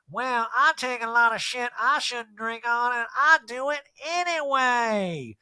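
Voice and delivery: high, slow, drawn-out mock voice; Erica impression. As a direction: imitate her with long stretched vowels. Retells Erica's brazen drinking line.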